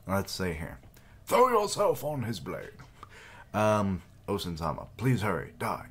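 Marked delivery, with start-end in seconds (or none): 1.3-2.7 loud, higher shouted command; fierce order. Acted. Commander ordering a soldier to die, loud and high. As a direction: bark the sacrifice order.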